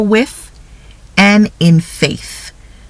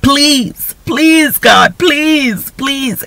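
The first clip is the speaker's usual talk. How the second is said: high-pitched mock begging, stretched pleases; pleading; 8 semitones higher, much slower than usual. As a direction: plead with drawn-out, repeated pleases.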